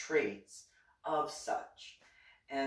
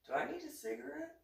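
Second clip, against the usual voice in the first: much higher and fast; joking mock alarm; 6 dB quieter, faster than usual. Mom joking to her son about needing a cigarette, high and quick. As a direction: toss off a wry joke in a rush.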